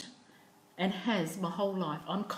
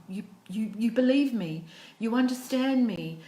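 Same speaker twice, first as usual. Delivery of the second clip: louder, higher burst of relief; retold relief, louder and higher; emotional outburst; 4 semitones higher, 6 dB louder than usual. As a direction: let the relief spill out loud.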